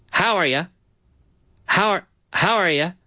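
"How are ya" run together as one flowing phrase; linked words. Shown as a demonstration. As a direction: blend "How are ya" into one smooth stream. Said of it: "We're linking the words together".

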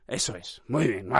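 low, soft aside; quietly pleased murmur to oneself; hushed self-approval. Real, not acted. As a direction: drop right down to congratulate yourself. Mutters approval to himself after rant.

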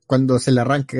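usual speaking voice

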